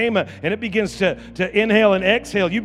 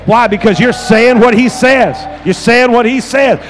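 preaching shout; 3 semitones higher, 10 dB louder than usual. Real, not acted. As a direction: drive it home with a full shout. Preacher's climax to congregation.

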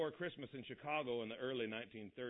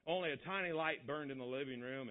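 ordinary delivery, nothing marked

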